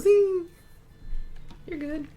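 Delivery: a bright jab then a quick compliment; cheeky zing. Retold or imitated. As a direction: Lena ribbing Mercy, loud and high. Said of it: "Lena kinda just goes".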